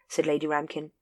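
plain reading voice

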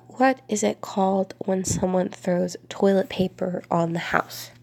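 ordinary delivery

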